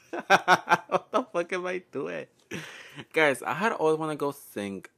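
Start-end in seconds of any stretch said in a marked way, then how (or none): 0.1-2.3 slow, laughing through the words. Real, not acted. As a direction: giggle out each word slowly. Laughing after a silly sung bit.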